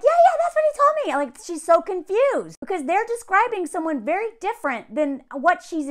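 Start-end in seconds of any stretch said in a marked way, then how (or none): 0.0-1.2 much higher, fast mock agreement; mimicking Chelsea. Imitating Chelsea agreeing, high and fast. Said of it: "She's like".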